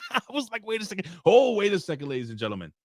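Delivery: high voice squeezed through laughter; laughing protest. Real, not acted. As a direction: crack up while trying to interrupt. Cracks up after the pilot joke.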